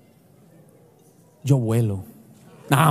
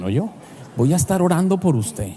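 usual speaking voice

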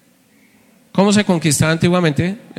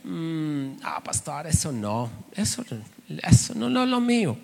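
much softer, bashful mumble; shy bachelor; 8 dB quieter, slower than usual. Retold or imitated. Shy brother dodging marriage questions, soft and bashful. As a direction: squirm out of the question.

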